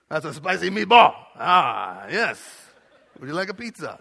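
loud hammed-up Italian accent, laughing; jokey Italian pirate accent at Chuck E. Cheese. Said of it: "sounds like an Italian pirate that works at Chuck E. Cheese".

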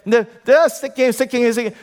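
high, eager dog repeating itself; excited dog. Acted. Acts out dog begging for more.

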